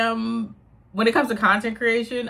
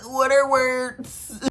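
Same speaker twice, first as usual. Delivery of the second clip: mock blank mind; 6 semitones higher, much slower than usual. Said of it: "I turn on the camera and I'm like".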